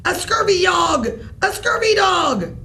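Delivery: high, loud repeated pirate shout, each rising; a broken pirate toy yelling scurvy dog. Acted. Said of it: "that bellowed".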